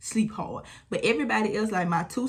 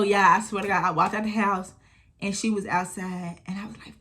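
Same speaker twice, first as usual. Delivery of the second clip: ordinary talk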